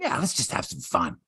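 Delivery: low, deadpan mock agreement; mock nervous kid going along with it; sarcastic kid. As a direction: say it like nobody could believe it. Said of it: "Do you honestly think this kid is going".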